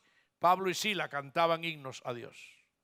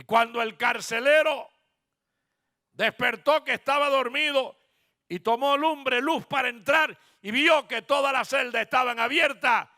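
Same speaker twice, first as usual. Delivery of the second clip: loud, high pulpit proclamation; impassioned preacher's cry; preaching at full force; 8 semitones higher, 9 dB louder than usual. Acted. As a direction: declare it to the congregation, raised and emphatic.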